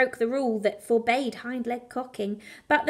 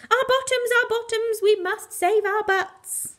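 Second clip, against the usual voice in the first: high, louder, slowing alarm; frantic; 9 semitones higher, 4 dB louder than usual. Acted. Clive urges the mutts to save their bottoms, frantic and high. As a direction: plead urgently for the bottoms. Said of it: "said Clive".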